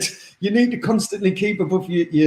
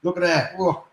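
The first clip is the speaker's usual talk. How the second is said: leering lads; 3 semitones lower, 4 dB quieter than usual. Retold or imitated. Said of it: "loads of lads ... going".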